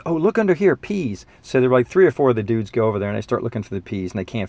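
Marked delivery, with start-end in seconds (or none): 0.1-1.3 mock clueless kid. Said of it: "the guy goes".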